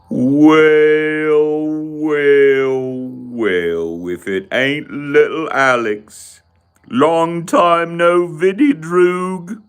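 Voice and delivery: drawn-out villain greeting; Clockwork Orange gang thug. Acted. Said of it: "You're talking about Clockwork".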